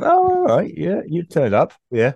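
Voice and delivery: high, loud mock acceptance; easily convinced. Character welcoming an unexpected arrival, easily won over. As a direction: imitate someone readily going along with it. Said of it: "No, he's kind of".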